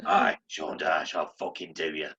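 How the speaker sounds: low, gravelly, slow threat; gruff hard-man growl; Sean Dyche impression.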